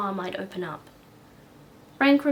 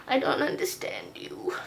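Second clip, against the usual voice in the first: whispering; 3 semitones higher than usual. Real, not acted. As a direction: whisper it tenderly. Whispering to her cat.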